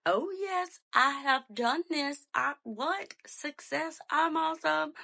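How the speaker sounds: mock self-congratulation; triumphant. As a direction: crow about your success. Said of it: "So I'm like".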